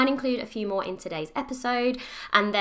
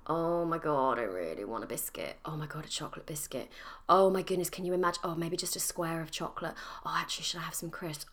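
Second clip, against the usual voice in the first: much softer, lower, half-whispered longing for food; craving murmur; 4 semitones lower, 7 dB quieter, faster than usual. Retold or imitated. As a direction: sigh over snacks you can't have. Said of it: "I would spend most of the day going".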